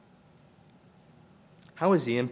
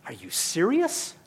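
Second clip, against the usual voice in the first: slow, higher, rising disbelief; mock disbelief; 8 semitones higher, 3 dB louder, slower than usual. Shown as a demonstration. Modern serpent mocks God's command, slow disbelief. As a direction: ask it like you can't believe it. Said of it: "That's what we'd say today".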